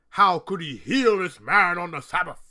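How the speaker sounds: loud outraged demand; angry Pharisees. Retold or imitated. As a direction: hurl the question like an indignant Pharisee. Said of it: "But the Pharisees are angry".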